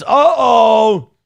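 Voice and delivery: playful alarmed uh-oh; loud mock alarm. Real, not acted. Reacting to membership milestone.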